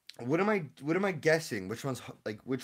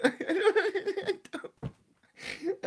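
breathless laugh; much slower than usual. Real, not acted. Laughing in confusion.

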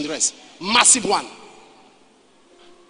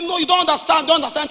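higher, louder insisting; urgent plea; 9 semitones higher, 5 dB louder than usual. Retold or imitated. Leader urging his men to drop the job, urgent and raised. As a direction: press it hard, almost frantic.

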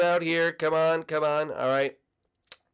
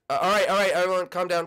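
ordinary talk, nothing marked

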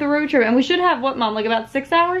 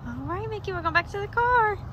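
high, soft chatter to a pet; light, lilting high voice, kept soft; pet talk; 7 semitones higher, 8 dB quieter than usual. Real, not acted. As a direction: talk sweetly to your pet, pitched up. Chatting to the pet while walking.

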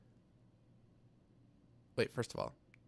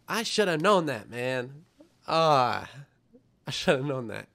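loud, higher groan of self-blame; frustrated self-scolding; 5 semitones higher, 11 dB louder, slower than usual. Real, not acted. Streamer scolding himself for a dumb mistake, loud frustrated groan. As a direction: kick yourself out loud for missing it. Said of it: "I'm so stupid".

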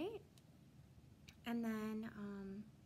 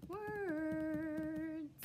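slow drawn-out; 6 semitones higher, 3 dB louder than usual. Shown as a demonstration. Drawn-out lesson goal.

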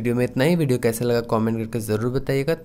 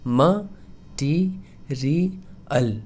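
म, टी, री अल split apart very slowly; syllable by syllable; much slower than usual. Shown as a demonstration. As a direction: break material into slow separate syllables. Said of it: "correct pronunciation होगा".

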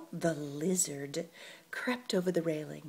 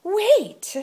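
desperate plea; 13 semitones higher, 12 dB louder than usual. Acted. Lizard pleading desperately.

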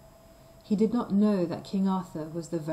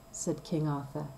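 plain reading voice

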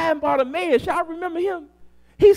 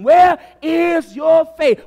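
slow, loud, high challenge; shouted, drawn-out question; preaching shout; 3 semitones higher, 6 dB louder, slower than usual. Acted. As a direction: call the doubters out from the pulpit.

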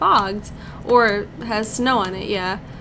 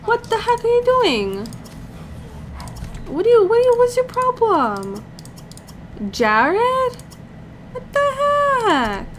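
shocked high yelling; 10 semitones higher than usual. Real, not acted. Player yelling at her Sim Jared, high shocked disbelief. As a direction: shriek at the screen in outrage.

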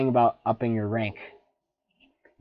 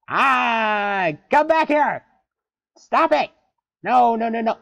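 high, loud, desperate shout; frantic yelling; 11 semitones higher, 8 dB louder, slower than usual. Real, not acted. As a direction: yell at it to come back. Fish escaping, yelling.

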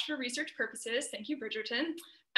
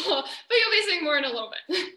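higher, louder, laughing through the words; 6 semitones higher, 9 dB louder, faster than usual. Real, not acted. Presenter promising the audience more, laughing. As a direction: laugh it off brightly after showing off. Laughs after her own demonstration.

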